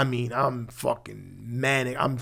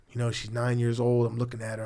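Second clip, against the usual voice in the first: normal talking voice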